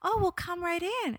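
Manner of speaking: a hint of the mom opening her door; mom's breezy open-door welcome; welcoming neighbor. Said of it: "she's like".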